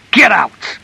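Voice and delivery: high, loud, explosive command; furious shout. A narrator's reading. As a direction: bellow it to drive her out. Husband's order repeated, escalating.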